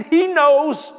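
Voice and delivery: high, loud brush-off; gruff brush-off. Retold or imitated. Husband dodging his wife's question, loud and curt. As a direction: wave the question away.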